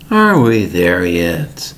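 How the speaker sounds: slow whine in a changed voice; whiny kid. Retold or imitated. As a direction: drag it out like a tired child. Kids whining in the car.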